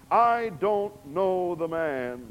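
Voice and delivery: loud, slow swearing denial. Acted. Peter cursing as he swears to bystanders, loud and slow. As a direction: hammer out each word.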